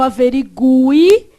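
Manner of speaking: "averigue" drawn out, with the u sounded before the final i; U pronounced. Shown as a demonstration. Teacher shows students the sound in "averigue", u clearly pronounced. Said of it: "só pra você diferenciar o som".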